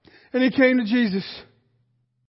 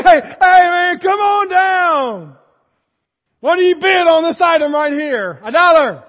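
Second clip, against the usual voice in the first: booming hammed-up game show host; 3 semitones higher, 6 dB louder than usual. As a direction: hype him up like a TV host calling contestants. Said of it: "I've watched too much Price is Right".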